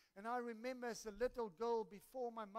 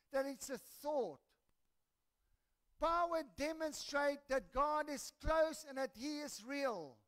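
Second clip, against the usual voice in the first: raised, louder preaching voice; emphatic preaching; 6 semitones higher, 6 dB louder than usual. Real, not acted. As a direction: drive the point home to the congregation. Preaches main point emphatically.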